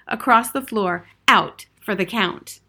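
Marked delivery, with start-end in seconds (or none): none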